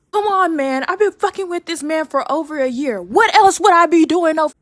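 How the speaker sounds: yelling. Retold or imitated. Defending herself to the girlfriend, yelling. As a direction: shout it in full outrage. Heated explanation in the argument.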